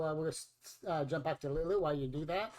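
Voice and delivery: normal talking voice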